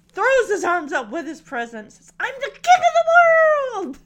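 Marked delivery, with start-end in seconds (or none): 2.2-4.0 high, loud movie-quote yell; triumphant shout. Retold or imitated. Acting out Titanic quote, arms up.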